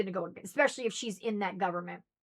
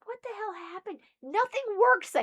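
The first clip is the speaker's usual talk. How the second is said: husband's bewilderment; 10 semitones higher than usual. Retold or imitated. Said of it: "He's up here going".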